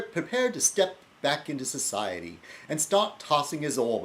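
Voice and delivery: a badly faked English accent reading Withnail; crappy English accent. Vlogger reading a film scene to camera in a crappy English accent. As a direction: ham up a clumsy English accent. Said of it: "my really crappy English accent".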